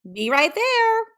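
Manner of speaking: high, loud giggly yell. A narrator's reading. Kids answer mom with a high, loud giggling yell. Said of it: "giggled and yelled".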